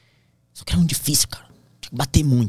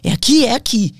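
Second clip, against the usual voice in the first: high, loud self-encouragement; fierce self-urging; 12 semitones higher, 7 dB louder than usual. Retold or imitated. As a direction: psych yourself up, pushing hard. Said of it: "respirava fundo". (incentivo intenso, alto e agudo)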